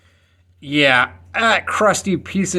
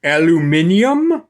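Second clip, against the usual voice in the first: aluminium said the British way, al-yoo-MIN-ee-um; mock British aluminium, five syllables; British pronunciation. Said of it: "my friends from that party we had back in seventeen seventy-six call it".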